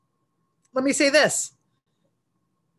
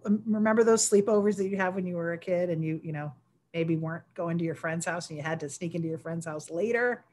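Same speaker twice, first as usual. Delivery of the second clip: usual speaking voice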